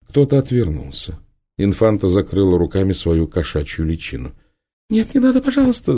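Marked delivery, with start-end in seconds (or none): 4.9-5.9 put-on high, whiny girlish pleading; whining girl. A narrator's reading. The girl whines at Prima to stop, high and tearful. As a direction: whine it like a frightened child. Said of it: "заныла девочка".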